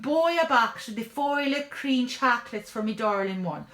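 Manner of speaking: lovestruck stage-Irish schemer; eager Irish charmer thinking aloud; hopeful Irish tinker. Acted. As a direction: plot dreamily in a comic Irish brogue.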